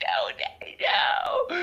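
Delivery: unvoiced pleading; whispered plea. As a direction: breathe the nos in a pleading whisper.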